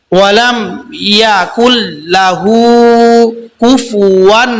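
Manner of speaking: লাহু with হু stretched one alif; tajweed recitation. Shown as a demonstration. Teacher recites the corrected verse, measured chant. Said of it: "এখানে এক আলিফ টান দিব".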